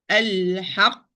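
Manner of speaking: "Al-haq" with a push just before the final q; nabr on qaf. Shown as a demonstration. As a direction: press into the q of "Al-haq" and stop. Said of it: "Like push in sound".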